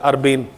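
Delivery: अर्बेन said as ur-bane with a long final e; teacher correcting अर्बन to अर्बेन; pronounce urbane. Shown as a demonstration. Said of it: "इसको अर्बेन पढ़ा जाता है".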